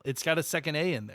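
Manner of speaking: usual speaking voice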